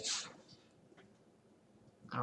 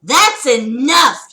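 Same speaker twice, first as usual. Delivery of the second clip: static voice; 6 semitones higher, 9 dB louder, much slower than usual. Acted. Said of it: "you heard a static voice".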